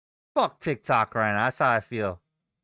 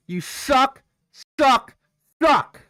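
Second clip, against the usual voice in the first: each suck higher and louder, building to a scream; building shout; 10 semitones higher, 7 dB louder, slower than usual. Real, not acted. Trader screaming at the chart, shout building louder and higher. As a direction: hammer the word suck harder every time.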